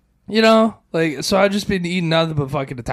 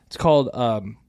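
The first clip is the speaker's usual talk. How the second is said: ordinary talk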